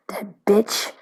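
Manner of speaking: breathy whispered aside. Real, not acted. Voicing the watchers' contempt for her, whispered. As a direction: drop to a bitter whisper.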